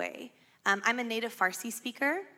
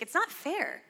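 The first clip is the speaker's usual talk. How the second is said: whiny complaint; 6 semitones higher, slower than usual. Retold or imitated. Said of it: "he's like".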